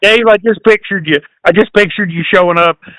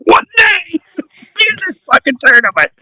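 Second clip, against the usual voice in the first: laughing mock; 9 semitones higher than usual.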